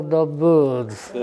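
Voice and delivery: laughing through the words. Real, not acted. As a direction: chuckle while finishing the joke. Laughing at his own joke.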